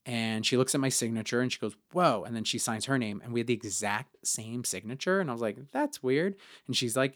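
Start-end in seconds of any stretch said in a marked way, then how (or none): 1.9-2.2 surprised whoa. Retold or imitated. Coworker reacting to matching signature. 5.7-6.4 mock puzzled. Retold own reaction, pitched up.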